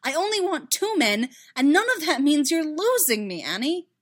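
high, loud, insistent declaration; exasperated, emphatic plea; exclaiming. A narrator's reading. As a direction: argue it fervently. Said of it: "Padme exclaimed".

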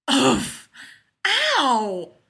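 loud winded grunt, then yelp; pained oof and ow. A narrator's reading. Leonard landed on by falling man, pained grunt and yelp. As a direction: get flattened, cry out in pain.

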